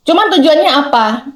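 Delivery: ordinary talk, nothing marked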